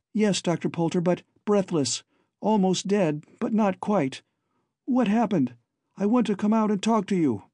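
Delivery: put-on high, light girl's voice calling up to the doctor; breezy and bright on the surface, fear underneath; lightly voiced Babs, light and laughing. A narrator's reading. Babs calls lightly up to Doctor Poulter, high and airy. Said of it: "she could call so lightly".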